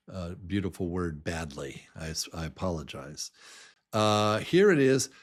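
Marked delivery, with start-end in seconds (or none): none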